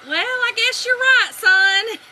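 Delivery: put-on high, loud, slow old fellow; old man conceding. Old man in line answers husband, high and slow.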